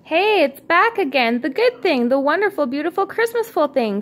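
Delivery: high, bright excited call; excited cry. A narrator's reading. Ornament cries out to the family in delight. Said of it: "cried Noelle".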